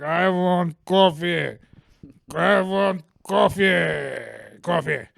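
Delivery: put-on drunk Russian customer. Barista imitating the drunk customer at the counter, slow slurred drunk voice. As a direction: drag it out like a drunk man demanding coffee.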